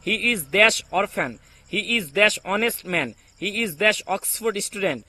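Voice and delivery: each word spaced out slowly, dash said as a blank; slow dictation. Shown as a demonstration. Teacher reading exercises aloud to viewers, very slow dictation. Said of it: "try করেন তো এই আর্টিকেলের".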